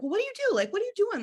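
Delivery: high, nosy friend asking what you do; curious friend. Retold or imitated. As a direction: play the friend poking with questions. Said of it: "if any of them are like".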